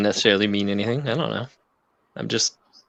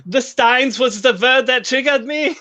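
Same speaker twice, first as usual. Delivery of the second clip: hammed-up activated German agent, pitched way up; German sleeper agent; 13 semitones higher than usual. Host teases co-host as a triggered sleeper agent, mock German accent. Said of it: "sleeper cell member from Nazi Germany".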